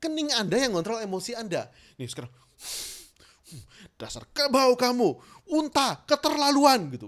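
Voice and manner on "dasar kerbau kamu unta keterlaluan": loud, high angry name-calling; angry insults. Shown as a demonstration. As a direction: frown and hurl the insults. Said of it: "gampang banget".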